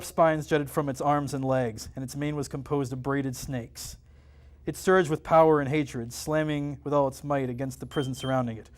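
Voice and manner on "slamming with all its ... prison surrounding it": ordinary delivery, nothing marked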